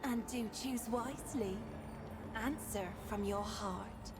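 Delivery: put-on bubbly sorority girl; Valley Girl accent. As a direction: ethereal spirit with Valley Girl lilt. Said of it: "why do you have, like, a Valley Girl accent?".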